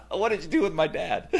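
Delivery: put-on dad amused at his kids' surprise, laughing; laughing disbelief. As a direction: laugh while acting stunned. Kids' stunned reaction, laughing.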